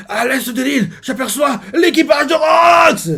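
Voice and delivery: high, far-carrying lookout shout; shouted alert. Retold or imitated. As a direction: call out the sighting across the sea. A lookout spots Rox's crew. (cri d'alerte aigu de la vigie)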